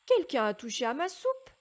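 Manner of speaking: ordinary delivery, nothing marked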